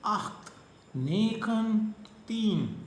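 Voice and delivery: slow, clear count for repeating; drilled counting. Shown as a demonstration. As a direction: sound out each Dutch number for students. Teacher counts Dutch numbers aloud.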